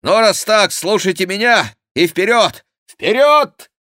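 loud, high order building to a shout; shouted command. A narrator's reading. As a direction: command loudly, then shout the last word. Chicot commands and spurs on. (громкая команда на скаку)

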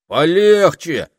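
loud, high, drawn-out objection; indignant high yelp of protest. A narrator's reading. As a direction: object indignantly, stretching the word. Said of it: "запротестовал Этельред".

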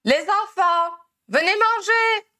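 high, loud call across the garden. A narrator's reading. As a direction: shout for the kids to come eat. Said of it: "Molly s'époumonna".

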